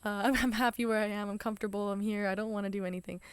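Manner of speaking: hammed-up put-upon whine; mock defiant brush-off. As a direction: play the sulky person who refuses to change. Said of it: "And then you're like".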